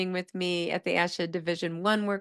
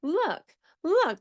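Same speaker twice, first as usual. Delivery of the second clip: higher, rising eager calls of look; excited child; 8 semitones higher, much slower than usual. Retold or imitated. Teacher imitating children pointing out the window, eager and high. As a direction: mimic a kid pointing eagerly. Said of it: "the very first word the children used was".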